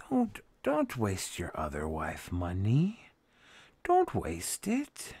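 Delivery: high, slow, soft chiding voice; lightly voiced doting scold; mock scolding. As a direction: wag a finger in a high, drawn-out voice. Scolds himself about money.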